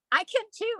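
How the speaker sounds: put-on high-pitched eager child; little kid. Twelve-year-old copying Archangel Michael's sword trick, childlike. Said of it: "like a little kid would".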